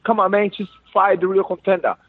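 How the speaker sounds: ordinary delivery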